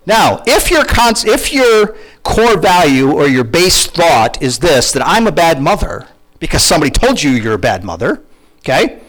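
ordinary delivery

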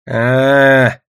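long, drawn-out let-down "ah"; old man's loud, disappointed drone; disappointed "aah". A narrator's reading. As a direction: draw out a dissatisfied "ah". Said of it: "разочарованно отозвался дед".

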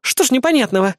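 put-on high, pouty girlish question; pouting. A narrator's reading. Azumi answering the captain's scolding, pouting. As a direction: pout as you ask. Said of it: "надулась Азуми".

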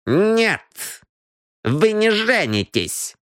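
high, deliberate refusal; sharp exclamation. A narrator's reading. Темпест forbids the cheat's marriage, sharp. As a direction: forbid it firmly, pitched high. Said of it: "резко воскликнул Темпест".

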